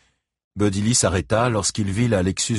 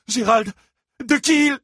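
high, anxious voice; alarmed questioning; 4 dB louder than usual. A narrator's reading. Christine turns anxiously to her husband, alarmed. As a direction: ask in rising alarm.

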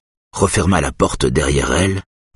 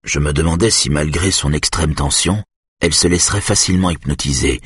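ordinary delivery

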